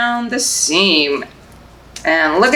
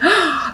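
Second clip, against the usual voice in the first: high mock gasp of delight; a short gasp of wonder; 9 semitones higher, 3 dB quieter than usual. Librarian to babies after the rhyme, playful gasp.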